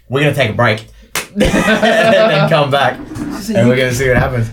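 announcing through real laughter; laughing. Real, not acted. Game master calling a break on a cliffhanger, laughing.

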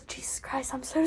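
a retold whisper from a test-stressed boy; hushed mock despair; whispered quote. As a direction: breathe it out like a defeated student. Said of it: "a boy that looked up and goes".

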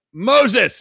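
eager quiz-show blurt; a mock eager shout of the answer. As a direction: shout it out high and loud. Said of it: "People are like".

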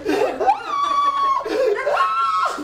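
loud laugh. Real, not acted. Laughing at the Epcot story.

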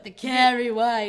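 loud, slow, hammed-up name reveal; mock-dramatic announcement of a name; over-dramatic reading. Shown as a demonstration. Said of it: "I'm reading it kind of".

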